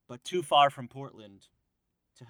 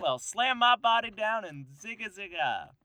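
put-on higher, slow old-timey prospector drawl; 6 semitones higher, slower than usual. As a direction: play a crusty gold-rush prospector. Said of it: "say it in an old-timey prospector voice".

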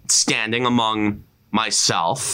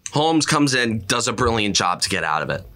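ordinary delivery

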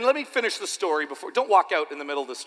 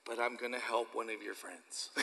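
put-on soft child reply; 6 semitones lower, 12 dB quieter than usual. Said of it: "And then she said".

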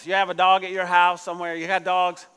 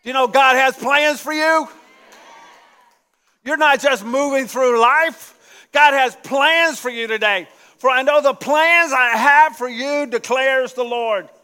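loud, higher, emphatic proclamation; preaching at full force; 3 semitones higher, 5 dB louder than usual. Real, not acted. Preacher pressing God's plans on the congregation, loud. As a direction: drive the promise home.

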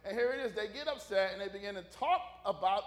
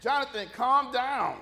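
calming; 3 semitones higher, 8 dB louder, slower than usual. Retold or imitated.

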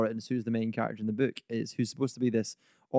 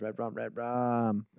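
slow, eerie, quieter chant of red rum; creepy Danny voice; 4 dB quieter, much slower than usual. Pretend. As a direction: drag out red rum like a haunted child. Voicing the creepy Shining line.

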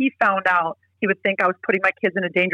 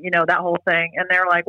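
usual speaking voice

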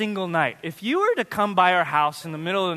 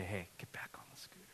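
whisper; 10 semitones lower, 23 dB quieter, faster than usual. Retold or imitated. Dad's blunt advice after comforting.

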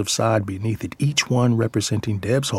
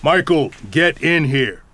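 firm, higher parental order; authoritative command; 5 semitones higher, 4 dB louder than usual. Acted. Parent ordering a son in from the yard, authoritative. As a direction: order him inside with authority. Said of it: "in our most authoritative voice".

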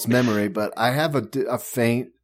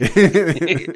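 laughing echo; 8 semitones higher, 8 dB louder than usual. Real, not acted. Echoing the comedian's punchline.

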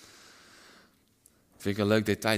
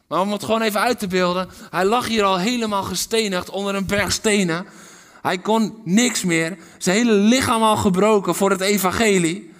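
loud, higher fervent sermon; full-force preaching; 9 semitones higher, 14 dB louder than usual. Real, not acted. (vurig luid preken)

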